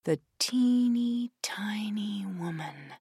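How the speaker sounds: put-on whispered teeny tiny. A narrator's reading.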